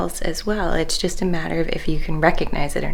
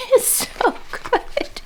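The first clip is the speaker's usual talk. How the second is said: high delighted squeak; delighted squeal; much slower than usual. Real, not acted. Delighted by the sun.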